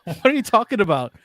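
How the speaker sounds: retold puzzled reply. Retelling their clueless reaction to the name, mock-baffled. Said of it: "They're like".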